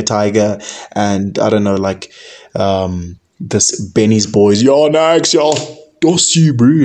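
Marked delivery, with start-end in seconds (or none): 4.6-6.8 imitating lads at a club in a London roadman accent. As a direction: call out to mates in a put-on London street accent. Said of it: "I'm so finished with this accent".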